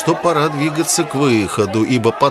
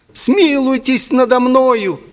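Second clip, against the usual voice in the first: high, slow falsetto, trembling with agitation; quavering falsetto; 14 semitones higher, 4 dB louder, slower than usual. A narrator's reading. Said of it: "дребезжащим от волнения фальцетом".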